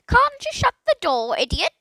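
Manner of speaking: high, loud, impatient squawk; impatient parrot voice. A narrator's reading. Parrot scolding Philip from nowhere, high impatient squawk. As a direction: snap it out like a cross bird. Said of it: "in a most impatient tone".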